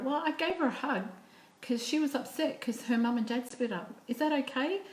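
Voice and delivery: a hint of a small boy explaining himself to Mum; high kid voice, checking for approval; little boy. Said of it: "little Johnny goes".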